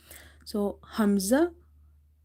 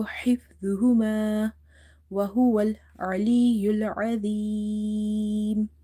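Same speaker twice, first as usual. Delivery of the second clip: Quran recitation; 4 dB louder than usual. Shown as a demonstration. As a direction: recite with long, held vowels. Recites the verse for learners.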